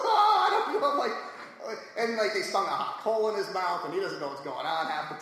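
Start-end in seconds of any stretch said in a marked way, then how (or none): 0.1-0.9 laughing. Real, not acted. Laughing after the whoa.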